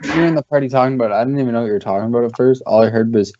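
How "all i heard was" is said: ordinary delivery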